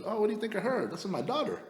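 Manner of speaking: high-pitched mock woman's voice; high, chatty impression of her; imitated woman. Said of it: "She goes".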